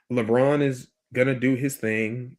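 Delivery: usual speaking voice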